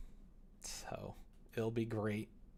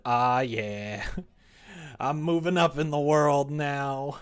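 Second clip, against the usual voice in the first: loud, higher celebratory crow; mock triumph; 4 semitones higher, 11 dB louder, slower than usual. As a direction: revel loudly in moving up. Celebrating being hosted by a viewer.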